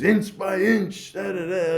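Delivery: high, slow, drawn-out comic menace; Three Stooges bit. Retold or imitated. Comic explaining the pandemic, slowly menacing Stooges voice. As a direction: creep it out like a slapstick villain. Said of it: "Yeah, Three Stooges".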